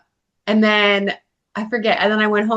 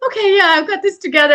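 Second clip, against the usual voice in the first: mock composing herself; 11 semitones higher, 4 dB louder, faster than usual. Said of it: "starts to, like".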